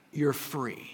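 soft, quiet, gentle release; God softly granting freedom; tender reassurance. Acted. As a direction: tell them gently they're free.

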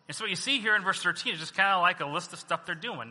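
ordinary delivery

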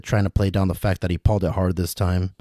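ordinary delivery

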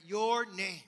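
"your" loud and drawn out; stress on your. Shown as a demonstration. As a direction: lean hard on "your". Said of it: "the emphasis is not on us".

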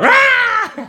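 loud, very high roar; excited yell. Retold or imitated. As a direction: roar it like an overexcited fan at a kick-out. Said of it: "his voice went up a notch".

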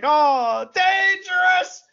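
high, loud, drawn-out mock cry; stretched, high-pitched imitation shout; excited commentator yell. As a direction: holler it like an overexcited commentator. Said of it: "And then he's like".

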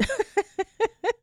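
high giggle after a bold line; light embarrassed laugh; sheepish laugh. Real, not acted. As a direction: laugh to soften the jab. Laughs after shaming joke.

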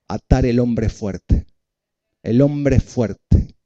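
each word of "hombre fuerte" drawn out slowly; slow it down so the flock can repeat; slow emphasis. Shown as a demonstration. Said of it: "Decí, ato al hombre fuerte".

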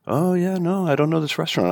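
high-pitched mimicked driver; clueless driver. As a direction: lift the voice high for the unknowing driver. Voices the Uber driver.

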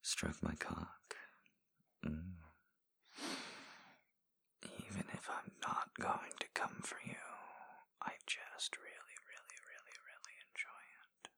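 soft, close whispered encouragement; intimate ASMR whisper. Acted. Voice in a lover's ear, guiding in whisper. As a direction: coax them on in a hushed whisper. Erotic audio whispered guidance.

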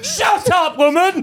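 high, loud mock shout; mock outburst. Man shouting down his friend in banter, mock outburst. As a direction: shout it in hammed-up indignation.

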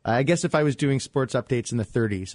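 normal talking voice

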